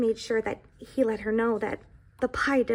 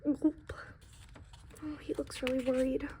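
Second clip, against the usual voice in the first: hushed aside; 5 dB quieter than usual. Acted. Reader aside about picture.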